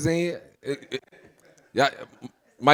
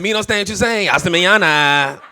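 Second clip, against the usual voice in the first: mock Spanish-accented hasta mañana, laughing; mocking broken English back; 3 semitones higher than usual. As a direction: throw Jose's line right back at him. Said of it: "I looked at his ass and said".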